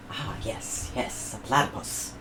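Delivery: soft, breathy musing; muttering to himself. Acted. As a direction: half-whisper as he mulls it. George muses to himself.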